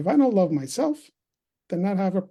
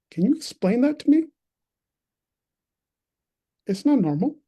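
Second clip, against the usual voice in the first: imitated exasperated mom, high and insistent; frustrated mother; 6 semitones higher, 4 dB louder than usual. Mom demands her single son explain himself, frustrated. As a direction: raise the pitch like a mother demanding answers. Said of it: "if it was frustration".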